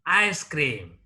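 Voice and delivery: "Ice cream" with ICE stressed, cream falling away; stress on first noun. Shown as a demonstration. Teacher showing a compound noun, "Ice cream" stressed on ICE. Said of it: "Stress is laid on the first".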